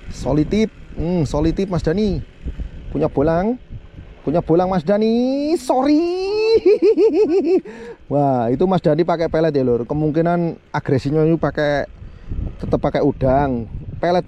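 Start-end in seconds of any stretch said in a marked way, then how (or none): 6.1-8.1 big high laugh after the catch; high burst of laughter. Real, not acted. Angler laughing at a lucky catch, delighted laugh.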